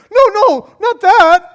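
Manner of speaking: high, loud shout of refusal; mock horrified protest. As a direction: cry out in hammed-up panic. Acting out horrified plea to God.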